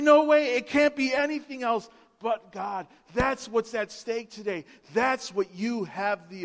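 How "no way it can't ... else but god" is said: louder, amazed No way; amazed exclamation. Retold or imitated. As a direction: burst out in disbelief at the change. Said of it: "And I say".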